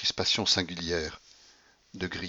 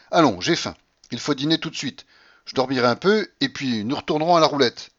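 high, louder grandmother voice; imperious old grandmother; 5 semitones higher, 6 dB louder than usual. Acted. Grandmother announces dinner, then roulette, imperious. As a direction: announce plans as orders.

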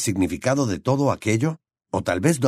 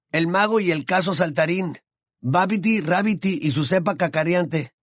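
put-on higher teasing boy's voice; higher young wizard voice; Ron's voice; 8 semitones higher than usual. A narrator's reading. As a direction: voice the gangly friend, amused.

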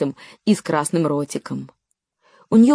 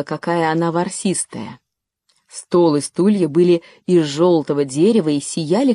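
plain reading voice

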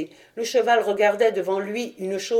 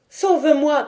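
higher, louder cry for rescue; desperate cry; 6 semitones higher, 4 dB louder than usual. Acted. The daughter cries to her father for rescue, desperate. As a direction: cry out in terror, hiding in his cloak. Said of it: "criait la fille de Gradelon".

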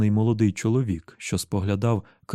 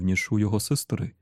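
ordinary delivery

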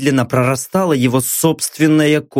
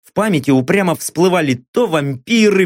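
ordinary delivery, nothing marked

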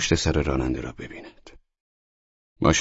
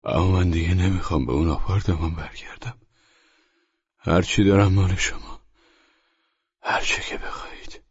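weakly; 3 semitones higher than usual. Acted. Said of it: "با ضعف و سستی گفت".

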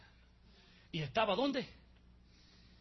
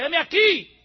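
loud reply; 12 semitones higher, 14 dB louder, slower than usual. Acted. Abraham answers the angel.

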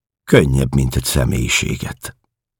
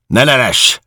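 much higher, loud bellow; roared; 12 semitones higher, 5 dB louder than usual. Acted. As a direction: roar her into silence. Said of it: "ordította Georg".

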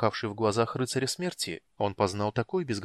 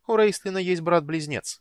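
higher, louder eager sister; 8 semitones higher, 6 dB louder than usual. Acted. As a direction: blurt it out brightly. Sister speaks up.

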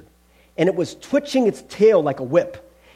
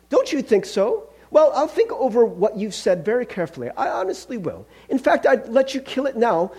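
higher-pitched, fussy excuse-making; evasive, flustered ghost; 5 semitones higher than usual. Acted. Ghost pleading poor health to the angel, evasive. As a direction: wriggle out of it politely.

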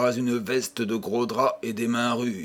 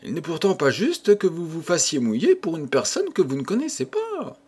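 lightly higher, coy grisette voice; young woman's voice; 6 semitones higher than usual. A narrator's reading. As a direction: voice the shop girl a little higher and demure. Grisette protests to Eugène.

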